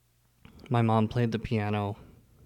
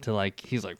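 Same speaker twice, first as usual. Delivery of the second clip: ordinary delivery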